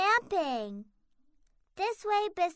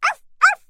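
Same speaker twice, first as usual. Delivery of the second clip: puppy barking; 12 dB louder than usual. Acted. Puppy barks at firefly.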